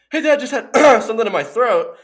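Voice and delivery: high, loud, overly sweet fake-innocent voice; innocent kid. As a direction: play the caught kid covering up. Caught by dad, faking innocence.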